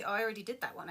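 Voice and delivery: normal talking voice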